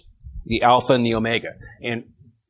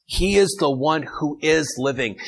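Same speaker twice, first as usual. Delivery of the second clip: usual speaking voice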